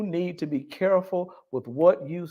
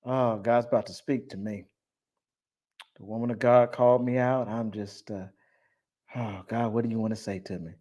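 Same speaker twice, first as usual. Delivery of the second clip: inner voice; 5 semitones lower, 6 dB quieter than usual. Retold or imitated. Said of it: "Her mind is saying".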